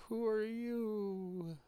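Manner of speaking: put-on high, drawn-out little boy voice; slow, high-pitched child's question. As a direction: stretch it out high, like a wary child at the door. Story boy answers the door.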